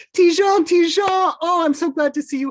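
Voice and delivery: high, loud happy calling out; wife greeting her husband with a loud joyful cry; joyful shout. Acted. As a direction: call him home from the balcony. Bertha spots husband, calls out.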